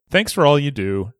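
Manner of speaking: ordinary delivery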